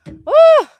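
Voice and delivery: very high, loud yelp of fright; a sudden jump-scare squeal; startled shriek. Real, not acted. Squirrel startles her mid-stream.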